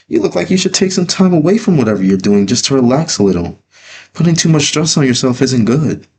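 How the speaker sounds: high gentle grandmother; lightly laughing old grandmother voice; grandma voice. A narrator's reading. Grandma voice in story.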